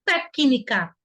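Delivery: TÉCNICA as "té-ki-ni-ca"; audible C. Shown as a demonstration. Teacher showing C has a sound, sounded out. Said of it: "Tem até um som com I desse C".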